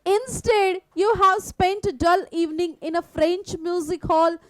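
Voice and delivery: slow, deliberate reading of the English text; slow story reading. Acted. Teacher reading Osable's words aloud to students, slow and deliberate. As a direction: read each phrase out slowly for the class.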